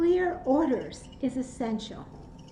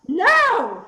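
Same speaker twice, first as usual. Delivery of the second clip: loud, high shouted no; yell; 5 semitones higher, 10 dB louder than usual. Shown as a demonstration. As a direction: yell it from the belly to stop an attacker. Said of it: "just to yell".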